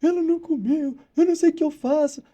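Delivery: frantic tutor calling the vet in tears; imitating tearful owner. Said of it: "ela me ligou chorando".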